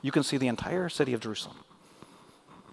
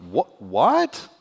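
surprised; 4 dB louder than usual. Acted. Feigned shock at God's words.

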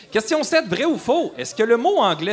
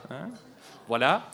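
ordinary talk

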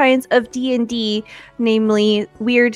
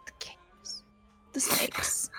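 whispering; 17 dB quieter, slower than usual. Acted. Drunk characters whisper about snakes.

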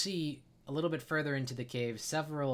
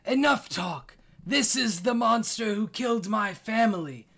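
much higher and louder, a furious scream; Elder screams; 10 semitones higher, 9 dB louder than usual. Acted. The Elder screams at the dragon over his dead family, furious. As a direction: scream it at the monster who killed your family. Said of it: "the Elder screams to you".

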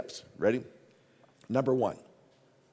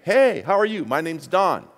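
friendly greeting demo; 8 semitones higher, 8 dB louder than usual. Shown as a demonstration. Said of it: "Just greet 'em".

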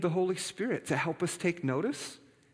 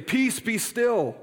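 slow, loud and higher, each word drawn out; commanding calm; 6 semitones higher, 5 dB louder, much slower than usual. Acted. Jesus commanding the storm to be still, slow and loud.

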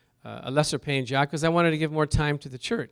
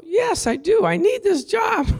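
much higher, pleading yes; eager high plea; 4 dB louder than usual. Retold or imitated. Answering the supervisor about the job, eagerly and much higher.

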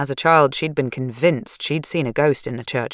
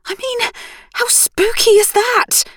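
Sarah, spooked; 5 dB louder, slower than usual. Acted. Sarah aghast at graveyard photos.

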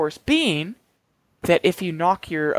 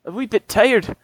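higher, louder mock Irish farmer; Irish brogue; 5 semitones higher, 5 dB louder than usual. Conor the farmer guarding his cabbages, in a hammed-up Irish accent. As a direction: ham up a stage Irish accent. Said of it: "[name] here".

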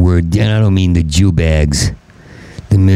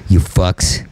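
ordinary talk

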